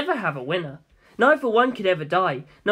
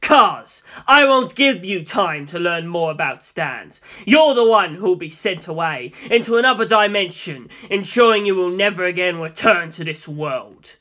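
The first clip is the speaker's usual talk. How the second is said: commanding threat; 5 dB louder than usual. Acted. Dio threatens Cars loudly.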